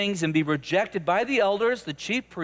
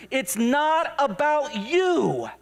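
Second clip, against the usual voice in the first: raised pitch, forceful and deliberate; emphatic correction; 6 semitones higher than usual. Acted. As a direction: drive the point home like a preacher. Jesus correcting Peter, preached emphatically.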